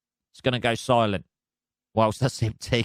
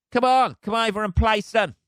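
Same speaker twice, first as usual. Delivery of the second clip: higher-pitched mock beckoning; goading invitation; 7 semitones higher than usual. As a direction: egg it on to come over. Acting out a goading call to dog.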